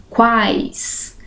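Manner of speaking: "quais" said softly, with the A heard most; teacher showing vowel and semivowels; example word sounded out. Shown as a demonstration. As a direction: say "quais", leaning on the A. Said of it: "a letra que eu ouço mais".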